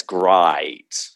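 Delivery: Australian "great" with a long, drawn-out diphthong; Australian accent. Shown as a demonstration. Showing an American how Australians say it, exaggerated Australian accent on "great". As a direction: stretch the vowel of "great" slowly towards an Australian "grite". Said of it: "If you're an Australian".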